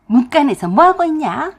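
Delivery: loud, high-pitched demand; impatient scolding. A narrator's reading. Mother-in-law interrupts the chat at the door, impatient and loud. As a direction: call out sharply like a cross mother-in-law.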